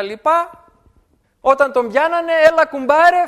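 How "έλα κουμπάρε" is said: loud call for help. Retold or imitated. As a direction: call out to the devil's crony. Thief calling the devil. (φωναχτό κάλεσμα του κλέφτη στον κουμπάρο)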